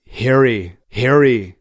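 mild Arnold saying "Harry"; Arnold's Harry. Retold or imitated. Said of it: "such a way of saying that word".

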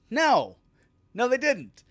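loud, high flat denial; emphatic shout. Real, not acted. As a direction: shout them down hard. Shooting down the fans' belief.

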